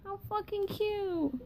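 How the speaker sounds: high, slow cutesy coo. Retold or imitated. Retelling cooing at the Oreo pack, slow and high. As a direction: coo over the little Oreos. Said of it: "I was like".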